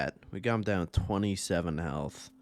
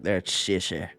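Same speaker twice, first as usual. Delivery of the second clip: put-on gruff old man; 4 dB louder than usual. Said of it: "he's just like".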